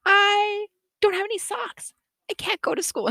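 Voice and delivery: high-pitched mock tantrum; acted tantrum. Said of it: "had a little, like, tantrum".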